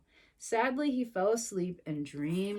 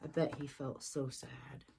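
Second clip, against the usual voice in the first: soft aside; 8 semitones lower, 11 dB quieter than usual. Real, not acted. Quiet aside to listening child.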